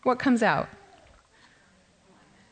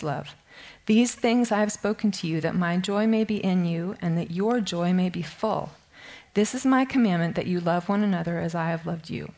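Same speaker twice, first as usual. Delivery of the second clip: scripture reading; 3 semitones lower than usual. Acted. Teacher reads the vine passage to her Bible study, low and measured. Woman reads John 15 aloud.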